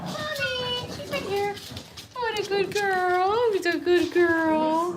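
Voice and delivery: high, slow, drawn-out praise for a dog. Real, not acted. Baby talk to the dog.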